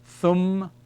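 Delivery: "Thum" alone, closed on a held m; "Thum" slowly, lips closing on a long m; syllable sounded out. Shown as a demonstration. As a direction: draw out "Thum" as a separate first syllable. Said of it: "it is pronounced".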